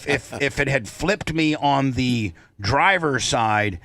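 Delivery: usual speaking voice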